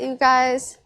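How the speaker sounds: ordinary talk, nothing marked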